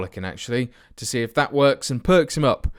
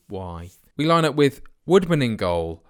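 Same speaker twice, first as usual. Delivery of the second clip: usual speaking voice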